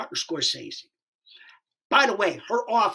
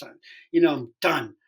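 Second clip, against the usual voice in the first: ordinary delivery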